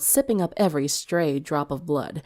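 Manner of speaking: plain reading voice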